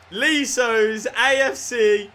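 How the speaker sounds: high, slow, loud announcement; proclaiming champions. Real, not acted. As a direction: crown the club champions like an announcer.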